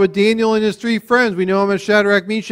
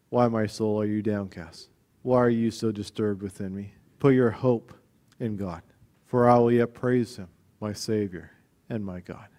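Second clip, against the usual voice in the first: low, soft, measured scripture reading; subdued and reverent, with pauses; hushed self-reassurance; 8 semitones lower, 9 dB quieter than usual. Acted. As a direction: quietly console your own downcast soul. Psalmist consoling his downcast soul.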